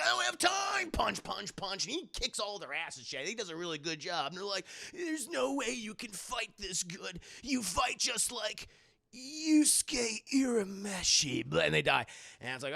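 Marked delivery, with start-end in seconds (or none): 0.0-0.9 high hammed-up yell, brushing them off; mock yell. Kuwabara yelling at gang. 4.9-11.5 slow, higher, drawn-out awe; awed thug. Retold or imitated. Awed thugs retold.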